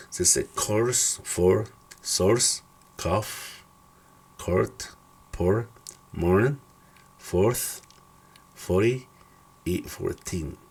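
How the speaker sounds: "course", "court", "fourth" with an O plus R; English OU as O. Shown as a demonstration. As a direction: say "course" slowly as a model. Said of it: "se pronuncia como O".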